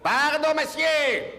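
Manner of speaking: very high, strained pardon monsieur; loud high call. Acted. Moviegoer calling to a seated man, very high and loud. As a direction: call out, squeezing past. Squeezing past seats in cinema.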